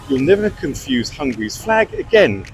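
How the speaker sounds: usual speaking voice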